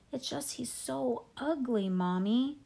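put-on slow, drawn-out small boy's plea; little kid. A narrator's reading. Little brother explains himself to Mommy, childish plea. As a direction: stretch it out in a childish voice. Small boy pleads with his mother.

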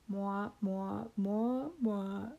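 muffled muted-trumpet mwah, like underwater; Charlie Brown adult voice. Retold or imitated. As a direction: mimic the wah-wah grown-up from Charlie Brown. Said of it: "like the way grown-ups talk in Charlie Brown movies".

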